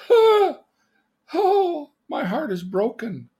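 high, loud melodramatic wail; hammed-up heartbreak, falling from a cry; heartbroken. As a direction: wail like a dumped teenager. Reenacts being dumped by girlfriend.

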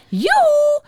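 very high, loud yoo-hoo; piercing witch's call; shrill. A narrator's reading. Witch calls from behind their backs, shrill. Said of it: "riep een schelle stem".

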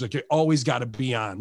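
ordinary delivery

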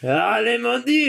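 high, hammed-up French exclaim; mock French cry to God; French accent.

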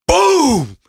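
high, punchy "Boom!" for a gunshot; shotgun boom. Retold or imitated. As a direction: fire it off like a shotgun blast. Shotgun blast in the story.

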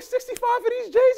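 very high squeal of a question; high-pitched mock shock at the price; delighted disbelief. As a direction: squeak it out, can't believe the bargain. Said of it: "I was like".

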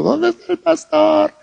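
high, thin lost-sheep cry; lost whimpering plea. Retold or imitated. Lost sheep crying out for the shepherd, high thin whimper. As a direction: plead like a lost little lamb.

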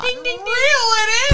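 bell imitation. Mock bell.

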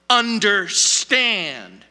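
"Understand" drawn out very slowly; emphatic command. Acted. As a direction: stretch "Understand" wide, leaning on the last syllable. Preacher repeats the quoted first word.

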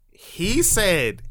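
normal talking voice

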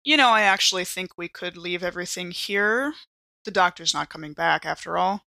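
usual speaking voice

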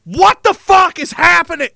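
high loud what is happening; bewildered shout. Retold or imitated. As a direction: yell in baffled alarm. Absurd afterlife reaction.

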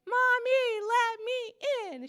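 put-on high, loud singsong child calling out; little girl's singsong. Three-year-old daughter begs at locked bedroom door, singsong plea. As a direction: imitate a small girl singing through a door. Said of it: "She has a little song".

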